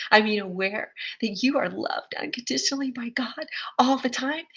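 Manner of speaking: whisper. Retold or imitated. Confiding God's love to clerk.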